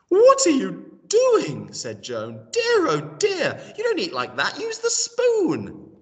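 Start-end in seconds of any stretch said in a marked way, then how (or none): 0.1-1.7 high, loud and slow appalled cry; a wife aghast at the boy's table manners; shocked scolding. Acted. Wife sees boy guzzling from bowl. 2.5-5.9 lightly voiced old wife tutting at the boy; fussing old wife. Wife correcting boy's eating.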